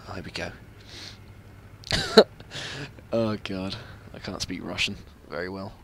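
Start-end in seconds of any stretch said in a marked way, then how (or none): 0.1-0.5 hushed whisper, barely voiced. Real, not acted. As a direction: breathe it out so the enemy can't hear. Sneaking up in the game.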